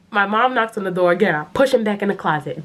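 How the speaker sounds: ordinary delivery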